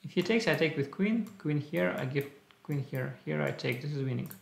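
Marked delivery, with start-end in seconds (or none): none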